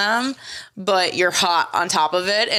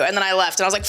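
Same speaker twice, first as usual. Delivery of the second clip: ordinary delivery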